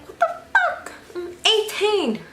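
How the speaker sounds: very high, piercing mock outrage; outraged squeal. Teen to mom demanding her phone, high outraged squeal. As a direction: shriek it at mom in disbelief.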